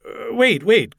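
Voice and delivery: high, flustered stammer. A narrator's reading. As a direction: blurt it high and hesitant. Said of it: "Gordon stammered".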